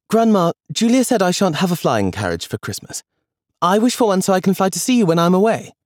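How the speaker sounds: put-on high, eager little boy voice; excited little boy pleading with grandma; child James. A narrator's reading. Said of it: "said James".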